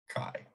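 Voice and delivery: "chi" said as KAI, rhyming with sky; pronunciation demo. Shown as a demonstration. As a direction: say "chi" with a hard k and long i. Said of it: "you say this like".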